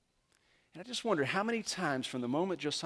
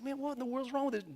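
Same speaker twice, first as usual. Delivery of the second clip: baffled outburst; 10 semitones higher, 5 dB quieter, faster than usual. Retold or imitated. Balaam baffled by stalled donkey.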